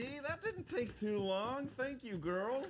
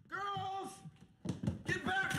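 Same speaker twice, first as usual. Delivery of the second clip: high, urgent holler; calling out urgently; 8 semitones higher than usual. Acted. Father calling girls back, high urgent yell. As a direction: holler after them, pitched high.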